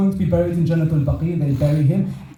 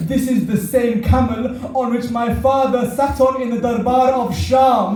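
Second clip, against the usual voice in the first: climactic mourning sermon; 8 semitones higher, 3 dB louder than usual. Acted. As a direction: preach at full force, calling mourners to grieve.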